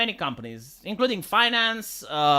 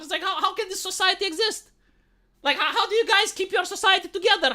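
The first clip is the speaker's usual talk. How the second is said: baffled disbelief; 7 semitones higher than usual. Retold or imitated. Baffled British professor's reaction.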